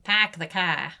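Boston "pahk the cah", R's dropped; flat-A Boston accent, "car" as "cah"; New England accent. Shown as a demonstration. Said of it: "the New England accent".

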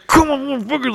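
very high strained; straining mock plea. [name] straining in the room, very high. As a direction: grunt it out in frustration.

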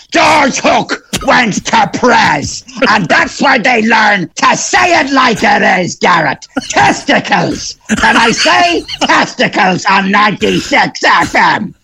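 slow, high, bellowing broadcaster caricature; George Hook. Acted. Comedian parodying a pundit to the radio host, slow strained bellow. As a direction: drag out each word in a strained, high shout. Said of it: "he sounds totally different to George Hook".

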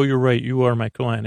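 usual speaking voice

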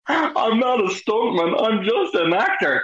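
high, slow mock whimper; whiny protest. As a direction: plead like an overwhelmed actor. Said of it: "I'm like".

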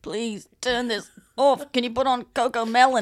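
lightly voiced pleading baby; imagined baby's plea. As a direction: playfully plead on the baby's behalf. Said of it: "She's like".

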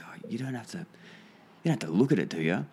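imitating a bashful bloke, tentative start; timid, shy. Shy client asking his meditating workmate not to look, timid. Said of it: "really timid, really shy".

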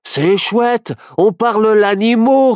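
high, loud, gleeful cub voice; merry high-pitched character voice; amused little bear. A narrator's reading. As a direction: crow with delight at the discovery. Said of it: "rigola Barnabé Ourson".

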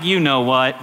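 low, insinuating devil; taunting enemy. Acted. Enemy to worshipper at the door, low taunt. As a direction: drop down into a sly reminder.

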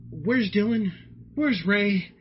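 high, loud, hammed-up cry for help; mock panic. Host pretending to be all alone, mock panic. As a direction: play panicking at being left alone.

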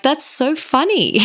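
high, tickled, ending in a giggle; giggling amusement. Acted. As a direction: giggle at your own stumble. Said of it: "have a little giggle at myself".

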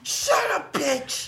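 high, loud snarling yell; robber shout. Acted. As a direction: scream it at the hostage. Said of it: "he goes".

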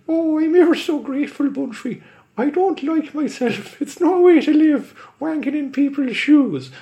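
high, loud, fast pleading stage Irish; leprechaun Irish accent. Acted. Leprechaun begs Bumfrey for release in Irish accent. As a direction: beg in a squeaky Irish leprechaun voice. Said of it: "lay on the accent so thick".

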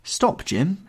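high-pitched exclamation; exclaiming. A narrator's reading. Girl cuts her brother off, exclaiming. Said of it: "she exclaimed".

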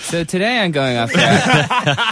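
laughing through the words; giggling while starting the show. Real, not acted. Host laughing at the intro.

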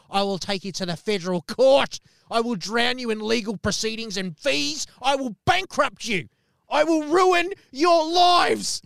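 from firm to high, loud, slow furious yell; building threat into a shout. Retold or imitated. Furious Jim threatening to sue, building to a slow yell. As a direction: escalate every threat until you're yelling. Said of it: "He's given it to us".

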